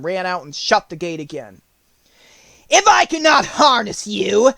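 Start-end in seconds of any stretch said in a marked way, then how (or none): 2.7-4.5 high, loud and slow witch. A narrator's reading. Witch speaking through the gate bars to the lion, menacing. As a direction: draw out the threat like a wicked witch. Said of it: "said the witch to the lion".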